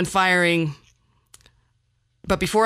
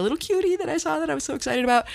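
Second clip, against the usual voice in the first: higher, fast gushing over a cute little thing; cooing over a cutie; 9 semitones higher, faster than usual. Retold or imitated. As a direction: gush like you just saw a baby animal. Gushing over a cute baby snake.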